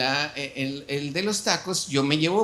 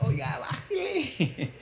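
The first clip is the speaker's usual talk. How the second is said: high, slow mock plea with a laugh; laughing while imitating the vendor's plea; taco vendor complaining; 10 semitones higher, 3 dB quieter, slower than usual. Taco vendors asking the pastor to turn the music down, high and laughing. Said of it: "me decían los taqueros".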